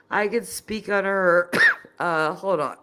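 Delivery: talking between coughs; hoarse voice broken by coughs; coughing. Real, not acted. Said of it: "I'm still coughing".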